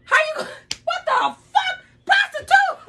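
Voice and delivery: high-pitched shout of disbelief; shocked outcry. Real, not acted. Mother confronting a lying daughter, shocked. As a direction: cry out, climbing high on each question.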